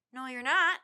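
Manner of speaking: loud retort; yelling back. A narrator's reading. Jack yells back down at Tad, loud retort. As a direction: shout it back to stop him. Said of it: "Jack yelled back".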